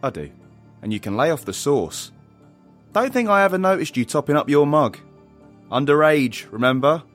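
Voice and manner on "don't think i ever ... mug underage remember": louder, higher telling-off; scolding. Acted. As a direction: scold a youngster firmly, voice raised. Parent figure scolding over drinking.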